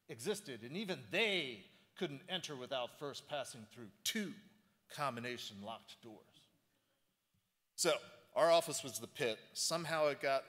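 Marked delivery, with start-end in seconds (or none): 0.1-6.2 reading aloud with theatrical weight; dramatic reading. Shown as a demonstration. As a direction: read it like a movie trailer. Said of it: "which I like to do a dramatic reading".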